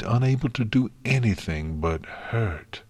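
usual speaking voice

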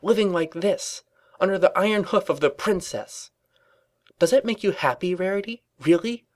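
high, pressing, incredulous questioning; impassioned rant. A narrator's reading. Twilight challenges Rarity about utopia, impassioned and high. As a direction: build a fervent challenge to a friend. Ranting against life under the princess.

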